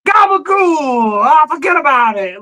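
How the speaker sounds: high, drawn-out street holler; loud Italian shout. Retold or imitated. Mimicking Little Italy heard over the muffler, loud high holler. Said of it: "Louder than my muffler".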